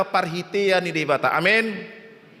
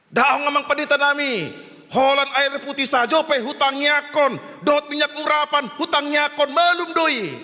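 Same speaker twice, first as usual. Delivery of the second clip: high, raised-pitch declaration in long held phrases; mock insistence, pitched way up and held; insistent believer; 9 semitones higher than usual. Believer refuses medicine before the pastor, high insistent proclaiming.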